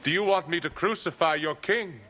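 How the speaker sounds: high-pitched public challenge; challenging the crowd. Acted. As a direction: put the question to a whole crowd, pitched high.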